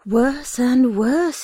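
slow, mock-gloomy sigh of a line; gloomily. A narrator's reading. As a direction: lament it with a twinkle. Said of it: "he said gloomily".

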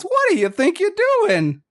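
high squeaky otter voice, indignant; gruff otter shipwright. Acted. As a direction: squeak it out like a fussy old otter.

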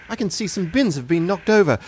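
ordinary talk

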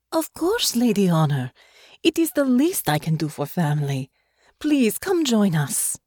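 a lightly voiced welcoming captain; gracious captain voice. Captain welcomes Honor warmly.

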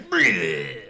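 high mock vomit noise; resounding retched bleh. Knight answers the captor, resounding retch. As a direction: heave out a big comic retch. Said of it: "He responds with a resounding".

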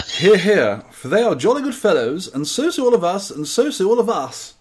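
jolly upper-class cheer; posh voice. Retold or imitated. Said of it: "he is very posh as well".

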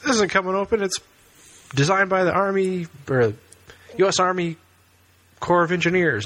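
high, sure boss voice; confident boss voice. Retold or imitated. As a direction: reassure him it's all safe. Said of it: "he says".

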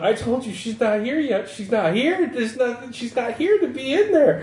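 high, upset insistence; crying. Acted. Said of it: "Richie's just crying".

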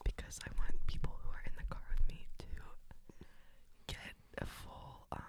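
whisper. Real, not acted. Whispering her answer to annoy the host. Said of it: "no one wants to hear you whisper".

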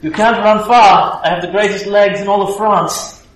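put-on mild comic French accent; a hint of a boastful Frenchman. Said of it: "talk in a French accent".

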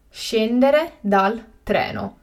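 slow and carefully spaced; slow, clear repetition. Shown as a demonstration. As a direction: say it again slowly for learners. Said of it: "teacher repeats the word twice".

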